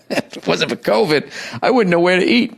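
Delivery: high voice breaking with laughter; laughing at own slip. Real, not acted. Podcaster catches himself saying covid for internet, real laughing. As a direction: laugh your way through it, pitched well up.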